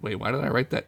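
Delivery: ordinary delivery, nothing marked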